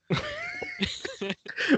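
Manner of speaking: high squeal of laughter. Real, not acted. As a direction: break into a shriek laugh. Laughing at the bit.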